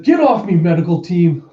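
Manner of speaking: high, loud burst of protest; mock outburst, starting high and dropping; irritated outburst. Injured sister to medical team, irritated outburst. Acted patient pushing medics away.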